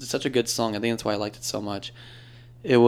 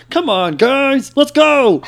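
much higher, louder, slow cartoon pig voice; Hamm impression; 11 dB louder, much slower than usual. Asked for Toy Story voices, much higher slow pig voice. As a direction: drag it out high and loud like a toy pig. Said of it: "I know the pig sounds like".

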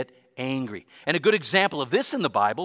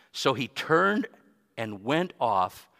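usual speaking voice

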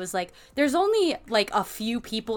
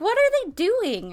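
puzzled question; 11 semitones higher than usual. Retold or imitated. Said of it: "like, was like".

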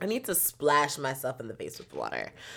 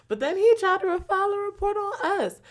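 indignant; 4 dB louder than usual. Real, not acted. Indignant aside.

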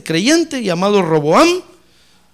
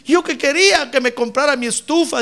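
mock wailing mother; 6 semitones higher than usual. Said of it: "los padres llorando".

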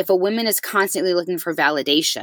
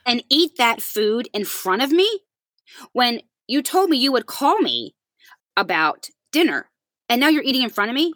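boiling mad; 6 semitones higher than usual. Retold or imitated. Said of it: "boiling at this point".

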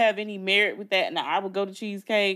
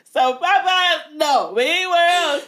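loud, high, drawn-out protest; loud emphatic; 8 semitones higher, 6 dB louder, slower than usual. Real, not acted. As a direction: shout the refusal slowly. Loud emphatic food rant.

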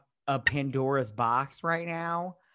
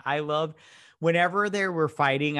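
ordinary delivery

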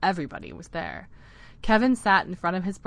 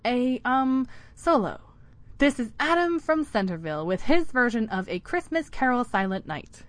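director voice; 6 semitones higher, 4 dB louder than usual. A narrator's reading. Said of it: "in her director voice".

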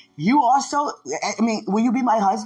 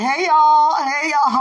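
loud, higher greeting to a crowd; mock call across a full room; 5 semitones higher, 7 dB louder, slower than usual. Standing at the altar greeting everybody, calling out.

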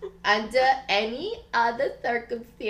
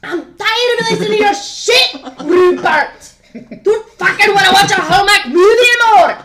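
shouted in mock fury at Rupert; acted yell; 11 semitones higher, 10 dB louder than usual. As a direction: yell it like a fed-up partner. Acted duck quarrel, shouting.

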